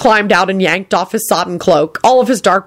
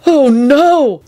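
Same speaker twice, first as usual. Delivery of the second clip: terrified; 8 semitones higher, 4 dB louder than usual. Acted. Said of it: "expression of mortal terror".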